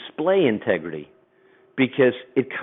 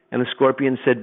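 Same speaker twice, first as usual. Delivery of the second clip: normal talking voice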